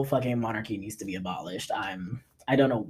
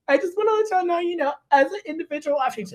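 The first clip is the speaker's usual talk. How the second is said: fake apology; faster than usual. Mock celebrity apology.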